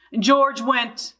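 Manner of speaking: usual speaking voice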